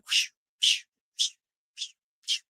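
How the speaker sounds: soft, voiceless repeated whooshes; whooshing noise. Retold or imitated. Storyteller showing the tooth flying out, soft whooshing effect. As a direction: imitate something flying off in airy puffs.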